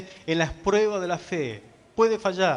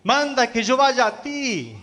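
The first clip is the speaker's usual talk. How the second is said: acted call across the water, high and drawn out; slow, raised-pitch retold plea; Pedro; 5 semitones higher, slower than usual. Pedro calling to the Lord from the boat, acted call. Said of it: "Pedro le dice".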